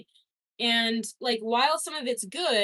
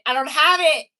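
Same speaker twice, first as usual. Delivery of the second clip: put-on French accent; 4 semitones higher, 9 dB louder than usual. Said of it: "No, I don't have it".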